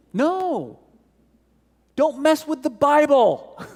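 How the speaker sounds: high, laughing mock scold; a hammed-up church official wagging a finger. Preacher voicing the church scolding Erasmus, high and laughing.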